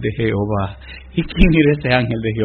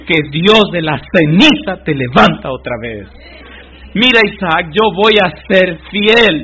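loud and high, declaring faith at the altar; preaching at full force; 5 semitones higher, 8 dB louder than usual. Acted. Preacher voicing Abraham and Isaac at the altar, loud. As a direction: cry out Abraham's vow, then Isaac urging him on.

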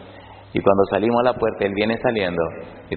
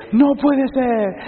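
mock astonishment; much slower than usual. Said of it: "va a pegar un grito".

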